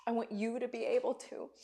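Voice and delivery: soft, tearful near-whisper; holding back tears. Real, not acted. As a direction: choke it out quietly through real tears. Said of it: "didn't mean to come on and cry".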